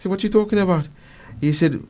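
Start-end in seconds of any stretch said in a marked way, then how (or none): 0.1-0.9 higher-pitched, lightly acted puzzlement; retold surprise. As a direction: ask as if you have no idea what they mean. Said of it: "Umar looked surprised".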